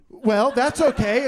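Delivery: ordinary talk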